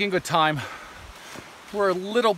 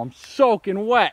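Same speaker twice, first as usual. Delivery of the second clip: slow, louder, higher wail; drenched and cold; 5 semitones higher, 6 dB louder, slower than usual. Real, not acted. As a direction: drag it out, dismayed. After falling in.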